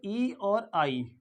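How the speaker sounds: ई और आई said very slowly; slow symbol demo. Shown as a demonstration. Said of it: "इसका symbol क्या है?".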